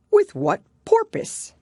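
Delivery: very high, loud and slow; a dramatic turtle asking the fish; Mock Turtle's question. A narrator's reading. Mock Turtle quoting itself.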